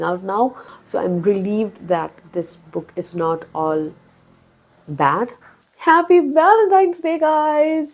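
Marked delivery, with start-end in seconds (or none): 5.8-7.9 much higher, louder bright greeting; cheerful greeting. Real, not acted. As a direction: greet your viewers with a burst of cheer.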